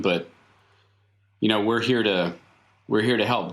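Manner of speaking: ordinary delivery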